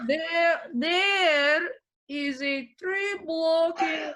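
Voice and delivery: put-on high, slow Russian accent; urgent, slow, high Russian-accented repeat. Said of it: "can you still do a Russian accent?".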